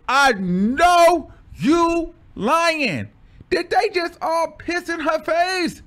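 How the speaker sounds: high, loud, slow disbelief; shocked disbelief. Retold or imitated. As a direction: gasp it out in outrage. Shocked reaction quoted.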